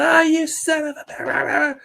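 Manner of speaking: high, drawn-out mock yell; imitated screaming fans. Imitating grandmother and her sisters at ringside, mock screaming. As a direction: imitate wrestling fans yelling, high and slow. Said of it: "they would fucking scream and yell".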